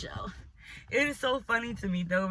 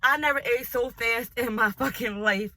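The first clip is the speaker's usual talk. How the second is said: ordinary talk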